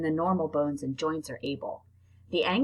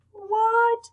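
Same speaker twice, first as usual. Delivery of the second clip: shocked; 5 dB louder than usual. Real, not acted. Reader hearing six males cling to one female, shocked squeal.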